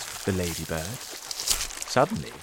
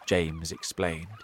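usual speaking voice

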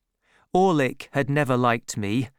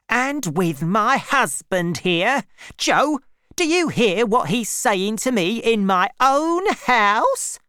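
shrill rising, much louder and higher; outraged appeal; 9 semitones higher, 5 dB louder than usual. A narrator's reading. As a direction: build to an indignant shriek. Sister appeals to Joe, outraged.

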